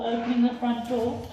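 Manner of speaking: ordinary delivery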